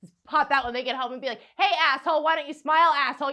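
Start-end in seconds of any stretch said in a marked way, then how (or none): 1.6-3.3 loud, pushy mock catcall; mock heckling. Comic popping out of bushes at a man, heckling loudly. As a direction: shout it like a street harasser.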